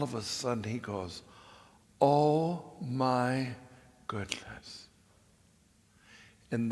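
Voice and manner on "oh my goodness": drawn out, fading to a whisper; hushed amazement. Retold or imitated. Friend suddenly spotting something on the window, hushed amazement. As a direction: let it trail into an unvoiced murmur.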